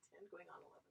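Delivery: hushed pointed aside; whispered aside. Acted. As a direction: whisper it, voiceless. Emphatic age aside.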